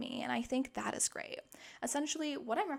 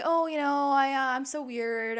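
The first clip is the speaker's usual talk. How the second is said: awkward self-deprecating; 3 semitones higher, 8 dB louder, slower than usual. Retold or imitated. Dodging a compliment from a friend, mock-awkward and loud. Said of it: "Don't say".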